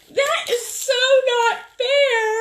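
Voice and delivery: very high, loud and slow, dragging out fair; mock crying protest. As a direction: sob it out like a wronged child. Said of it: "I'm like".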